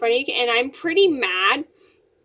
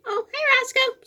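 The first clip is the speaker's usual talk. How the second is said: high sing-song pet talk; cat greeting; 14 semitones higher than usual. Real, not acted. Girl greets her cat Roscoe in a high cooing voice. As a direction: coo hello up high to the cat.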